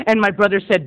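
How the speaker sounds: ordinary talk, nothing marked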